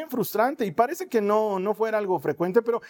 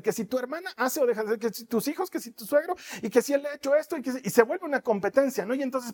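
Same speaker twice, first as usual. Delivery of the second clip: ordinary talk, nothing marked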